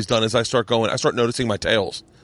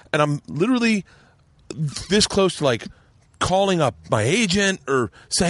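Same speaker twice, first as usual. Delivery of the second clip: usual speaking voice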